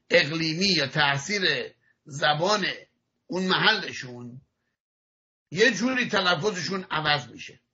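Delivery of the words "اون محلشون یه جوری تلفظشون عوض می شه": ordinary delivery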